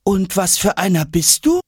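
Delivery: high-pitched curious question; curious little character. Acted. Travellers questioning Aladdin, high and curious. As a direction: ask it in a high little storybook voice.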